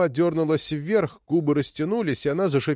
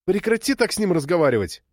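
higher, mildly raised voice; shouted rebuke; 6 semitones higher than usual. A narrator's reading. Daughter scolding her mother, understated shout. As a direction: cry out in reproach, not full volume. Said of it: "крикнула Роуз".

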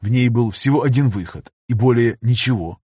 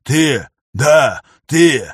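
out of breath; 3 semitones higher, 5 dB louder, much slower than usual. Acted. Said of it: "ткнул запыхавшийся".

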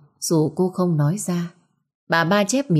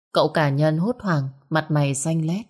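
ordinary delivery, nothing marked

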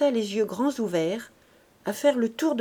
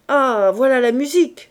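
loud, higher furious cry; furious outburst; 4 semitones higher, 10 dB louder than usual. A narrator's reading. Father exploding at his crying children, loud and furious. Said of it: "s'écria Lantier furieux".

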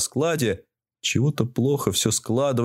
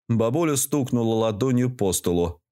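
ordinary delivery

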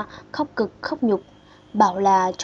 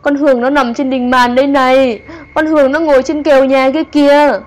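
terrified raving; 4 semitones higher, 10 dB louder than usual. Acted. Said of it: "Tay bà cứ chỉ trỏ".

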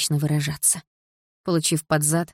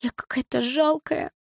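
higher, slow, small voice; tearful whisper; 8 semitones higher, slower than usual. A narrator's reading. Дилара confesses to Лёха, high, slow and tearful. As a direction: fight back tears. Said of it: "прошептала Дилара".

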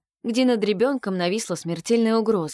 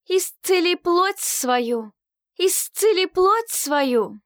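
slow, much higher ritual cry; rising to a shout; 11 semitones higher, 4 dB louder, much slower than usual. Acted. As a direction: chant it up into a shout. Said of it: "переходя на крик".

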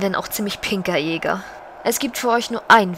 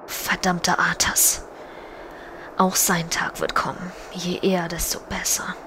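whispered threat against Arthas; vengeful whisper; 5 dB quieter than usual. Acted. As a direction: hiss the curse under your breath. Sylvanas curses Arthas.

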